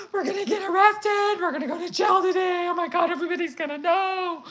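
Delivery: high, loud panicked cry; freaking out. Retold or imitated. Paranoid stoned daughter panicking inside her head, high and loud. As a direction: wail like you're about to be arrested. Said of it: "I'm freaking out".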